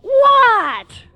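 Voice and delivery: high, loud, irritated what; mother in the kitchen yelling what; annoyed mother yell. Retold or imitated. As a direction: yell back like a busy mother. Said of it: "she yells back".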